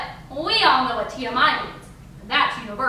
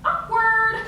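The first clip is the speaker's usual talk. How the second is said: singsong awkward; 6 semitones higher than usual. Acted. Comic aside after explaining to mother.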